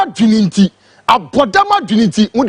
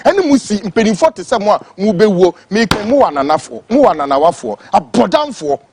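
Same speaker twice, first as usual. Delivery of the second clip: usual speaking voice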